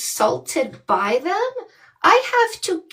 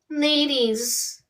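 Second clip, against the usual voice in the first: ordinary talk, nothing marked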